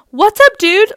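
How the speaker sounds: high, loud, over-casual greeting; hammed-up buddy hello; fake chill. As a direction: play it cool far too hard. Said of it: "so chill".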